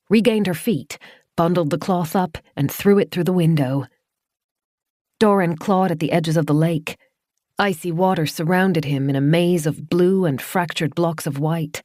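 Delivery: plain reading voice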